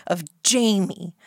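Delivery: usual speaking voice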